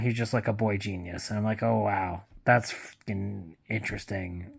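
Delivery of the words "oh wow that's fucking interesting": sarcastic. Retold or imitated. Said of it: "It's not".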